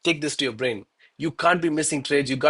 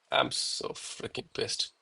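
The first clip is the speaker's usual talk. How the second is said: quiet read-out; 2 semitones lower, 11 dB quieter, slower than usual. Retold or imitated. Reading viewer's chat comment.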